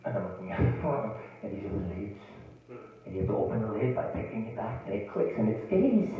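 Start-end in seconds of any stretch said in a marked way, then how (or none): 1.4-6.1 soft, patronizing cashier impression; imitated senior-splaining. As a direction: explain it softly, as to a confused senior. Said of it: "she freaking senior splains".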